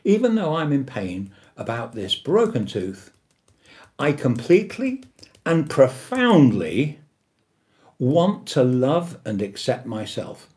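mean every word of it; affirmation demo. Shown as a demonstration. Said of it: "the words don't have to be identical every time".

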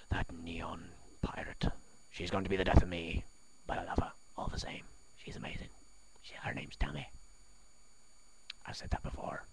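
soft secretive whisper. Acted. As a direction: whisper it fondly aside. Whispered asides about Tammy.

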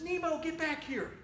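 lightly voiced dad ordering his kid back; worried dad calling. Dad calls his son back.

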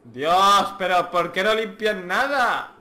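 loud, disgusted complaint; exasperated outburst. Real, not acted. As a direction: blast the filth in disbelief. Disgusto per la sporcizia.

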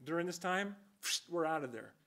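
ordinary talk

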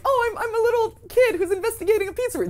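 put-on high, fast childlike voice; little kid voice. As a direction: chirp it like a small child. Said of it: "These aren't like".

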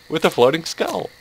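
ordinary talk

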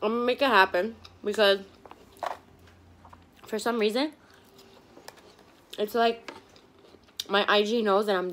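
speech muffled by food; words around a mouthful; talking while chewing. Real, not acted. Seen 3: cheeks puffed, jaw working; tomato bitten at 4.1 then chewed.

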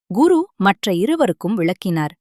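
usual speaking voice

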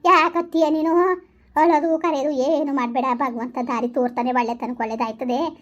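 ordinary delivery

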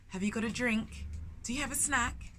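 usual speaking voice

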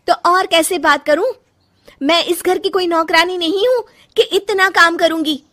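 loud, high, defiant snapping at the mother-in-law; angry retort. Acted. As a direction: talk back hotly. Daughter-in-law quarrels with mother-in-law. (गुस्से में बहू सास को जवाब देती है)

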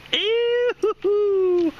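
high squeal of disgust. Real, not acted. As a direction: squeal at the slime. Dog slobber.

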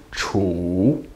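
chu with a low dipping third tone. Shown as a demonstration. Teacher demonstrating the third tone, chu dipped low. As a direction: dip low on chu then rise. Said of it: "third tone".

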